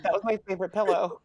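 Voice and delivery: mock-upset quote through giggles; laughing mock complaint. Elena to Bonnie about the ripped pillow, laughing mock complaint. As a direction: protest while trying not to laugh.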